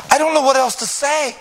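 high, loud, broken pleading; grieved. Acted. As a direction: pour it out in anguish. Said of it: "Peter was grieved".

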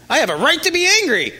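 high, fast indignant protest; indignant retort. Retold or imitated. Listener told to calm down, protesting indignantly. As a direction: snap back that you have every right to be angry. Imagined angry retort to being calmed.

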